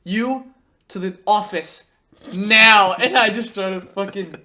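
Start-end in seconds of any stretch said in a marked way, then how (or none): 0.0-2.9 slow, loud order with a hard final word; mock stern teacher. Teacher sending student to the office, mock stern. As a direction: send a pupil out with overplayed sternness. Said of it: "stern, like, look".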